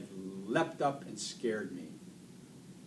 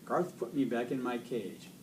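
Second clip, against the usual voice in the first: ordinary delivery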